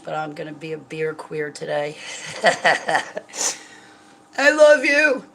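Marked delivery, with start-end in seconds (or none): 2.4-5.2 high, loud, blubbering I love you; mock her own tearful drunk self; drunk crying self. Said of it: "and crying".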